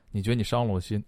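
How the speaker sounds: ordinary talk, nothing marked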